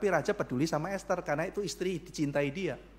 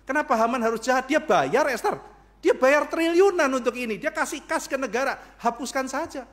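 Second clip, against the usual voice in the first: loud dismissal; 7 semitones higher, 5 dB louder than usual. Acted. King defends Haman to Esther.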